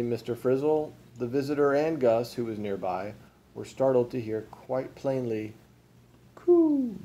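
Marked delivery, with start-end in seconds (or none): 6.3-7.0 very high, loud coo; baby coo. A narrator's reading. Baby ghost is heard from the cradle, cooing.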